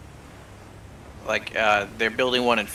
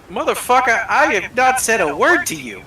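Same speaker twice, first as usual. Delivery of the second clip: indignant retort; 9 semitones higher, 3 dB louder than usual. Retold or imitated. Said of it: "the other guy was like".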